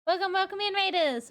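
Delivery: high, loud bubbly greeting; cute anime streamer. Acted. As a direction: greet the raiders like an excited idol. Greeting incoming raiders on stream.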